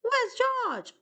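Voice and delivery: high urgent call for George; calling out in alarm, pitched high. Acted. Sailors searching for lost monkey.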